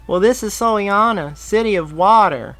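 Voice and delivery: put-on high, loud proud boy; happy exclamation. A narrator's reading. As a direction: announce it like a proud kid. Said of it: "the boy happily exclaimed".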